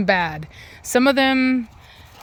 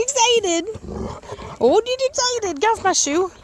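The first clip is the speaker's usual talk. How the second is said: excited dog-talk voice; 10 semitones higher than usual. Real, not acted. Owner greeting her jumping dogs, very high excited baby talk. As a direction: gush at the pups in a squeaky voice.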